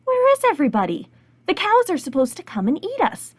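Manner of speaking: high-pitched talking grass; small high voice. Acted. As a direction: pipe up as puzzled blades of grass. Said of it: "all of the grass said".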